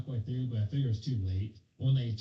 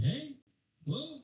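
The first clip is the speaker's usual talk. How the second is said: calling out. Retold or imitated. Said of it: "I kinda wave and I'm like".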